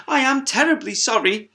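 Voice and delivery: apologetic hippo. Acted. Sheila apologises.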